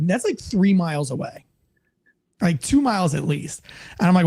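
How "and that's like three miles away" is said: ordinary delivery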